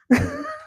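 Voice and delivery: high giggle; high laugh. Real, not acted. Laughs after singing.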